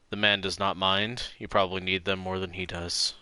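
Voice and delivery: calm narrator read of game text; game narration read. Acted. Streamer reading the detective game text aloud, calm narration. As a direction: read the scene to viewers, calm and even.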